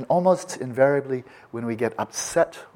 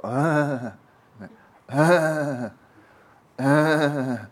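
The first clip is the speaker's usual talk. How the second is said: higher, louder strained ahh of a car failing to start; imitated engine sputter; 5 semitones higher, 5 dB louder, much slower than usual. Teacher imitating a car that won't start, higher and louder. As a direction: groan out like an engine that won't turn over. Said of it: "You just go".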